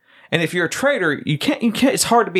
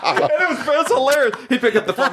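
laughing through the words, voice climbing high; can't stop giggling at the memory; 12 semitones higher, faster than usual. Real, not acted. Recalling the phone bromance.

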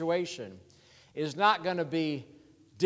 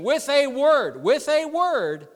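high, loud, repeated punch on "with a word"; "with a word" lifted high and forceful; emphatic preaching; 7 semitones higher, 5 dB louder than usual. Real, not acted. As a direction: drive "with a word" home, pitched up.

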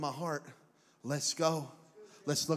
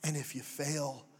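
whispering; 4 semitones lower, 5 dB quieter, slower than usual. Real, not acted. Soft close.

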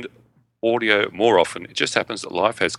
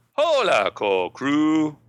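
Mexican accent; 6 semitones higher, much slower than usual. Shown as a demonstration. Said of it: "my, uh, Mexican accent is worse".

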